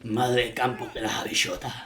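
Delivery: put-on laughing, thick rural accent; rural Andalusian. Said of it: "would, would say".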